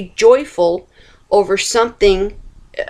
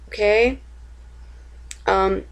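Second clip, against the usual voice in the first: ordinary delivery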